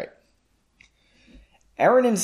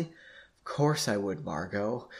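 slow, soft and breathy; softly; 5 semitones lower, 8 dB quieter, slower than usual. A narrator's reading. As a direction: say it softly, drawn out. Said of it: "he responded softly".

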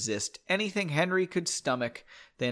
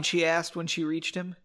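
ordinary delivery

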